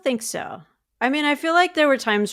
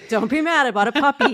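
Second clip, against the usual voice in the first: high, loud sheepish plea; pleading excited; 6 semitones higher, 5 dB louder than usual. Pretend. Wife pretending to confess a puppy to husband, high and pleading. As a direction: beg forgiveness eagerly, pitched up. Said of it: "I'm like".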